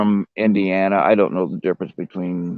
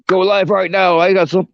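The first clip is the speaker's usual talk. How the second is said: higher-pitched imitation of a friend; imitating excited caller; 10 semitones higher than usual. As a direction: mimic her urgent call, pitched up. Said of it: "I can't emulate her".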